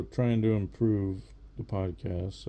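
ordinary talk

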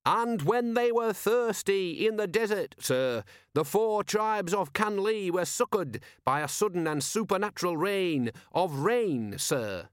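high, slow, fervent recital; zealous believer, voice lifted and drawn out; absolute conviction. A narrator's reading. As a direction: proclaim the miracle with total belief. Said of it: "Visit's absolute conviction".

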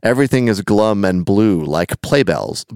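slow, measured recital of song lyrics; deliberate, rhythmic read-aloud; verse recitation. Acted. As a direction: recite the verse without singing it.

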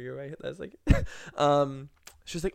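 ordinary talk, nothing marked

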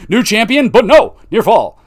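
high, loud commentator call; thrilled ringside yell; excited shout. Pretend. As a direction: call the near fall like the crowd's about to win. Excited play-by-play of near fall.

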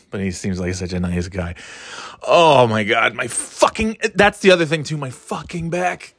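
ordinary talk

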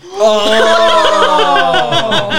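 long, loud "oh" of dismay; high, drawn-out groan at bad luck; sympathetic groan. Real, not acted. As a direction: wail in sympathy, half laughing. Player reacts to failed roll.

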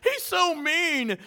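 high, slow, drawn-out whine; mock whining father. Fathers griping about the preacher, mock childish whine. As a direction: complain like a sulking child.

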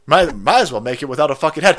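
ordinary talk

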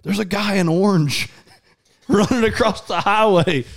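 high, slow amazed report; Delaney spotting an inmate on the highway; astonished. Retold or imitated. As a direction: say it in disbelief. Sister shocked seeing inmate.

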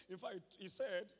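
usual speaking voice

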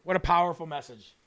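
ordinary talk

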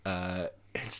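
normal talking voice

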